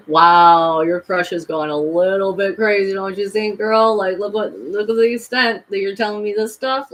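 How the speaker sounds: loud, sneering friend's voice; mock them, loud and scornful; a hint of a scornful friend; mocking skeptic. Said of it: "and they say".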